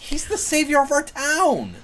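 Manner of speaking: protest, shocked; indignant. Acted. Character protests defending lord.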